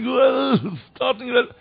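crying out. Retold or imitated. Rabbi on the train calling to his Father, imitated high cry. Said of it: "dann schreit er".

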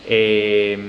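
ordinary talk

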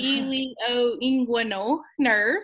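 "ilioinguinal" said slowly, syllable by syllable; broken into syllables. Shown as a demonstration. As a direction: sound out "ilioinguinal" carefully, one syllable at a time. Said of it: "I had to break it into syllables".

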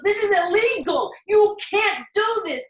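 husband screaming at police, reenacted angry yell; retold scream. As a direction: relive him screaming at the officers. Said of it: "he was just screaming".

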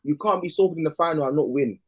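ordinary delivery

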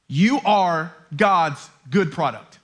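low, slow and deliberate; earnest declaration. Real, not acted. Preacher asking listeners to hear him, low earnest declaration. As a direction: let each word land gently but firmly. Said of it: "please hear me say this".

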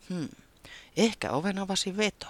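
plain reading voice

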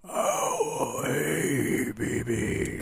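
soft breathy whisper in the ear; sweet nothings. Shown as a demonstration. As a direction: whisper it close and intimate. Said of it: "whispering sweet nothings in your ear".

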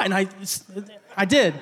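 ordinary delivery

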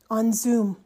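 ordinary talk, nothing marked

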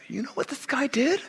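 mock gossipy Pharisee telling on him; tattletale Pharisee. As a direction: tell on Jesus like a sneaky snitch. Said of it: "that's how they all talked".

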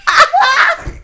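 high, loud through laughter; laughing hard. Real, not acted. Teasing her friend about the scary scream, laughing hard. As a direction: squeal it while laughing.

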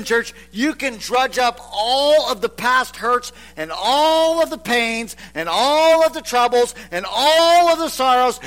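normal talking voice

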